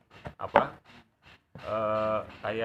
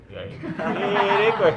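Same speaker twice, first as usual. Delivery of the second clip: Indramayu friend; 7 semitones higher, 8 dB louder, much slower than usual. Retold or imitated. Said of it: "Sira sih mari ndirek".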